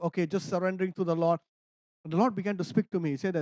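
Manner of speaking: normal talking voice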